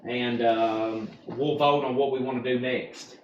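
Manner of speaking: winded, breathy and soft; out of breath. Real, not acted. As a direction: talk while catching your breath. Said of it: "I'm out of breath".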